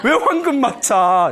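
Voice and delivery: acted incredulous reaction; dumbfounded. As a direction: play the flabbergasted children for laughs. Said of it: "더 기가 막혀".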